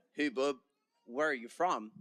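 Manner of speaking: British speaker badly imitating an American; American accent. Student retelling a stranger's question, mock American accent. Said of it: "that's my American accent right there".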